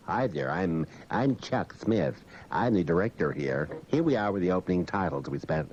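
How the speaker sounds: a mock serious filmmaker guiding you through his DVD; director's commentary voice. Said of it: "the director's cut with a commentary".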